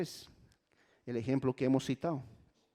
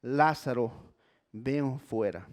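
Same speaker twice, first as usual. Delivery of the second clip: ordinary talk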